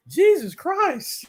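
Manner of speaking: very high, drawn-out meme impression; mock startled. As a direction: imitate a scared little kid from a meme. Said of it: "somebody scares him".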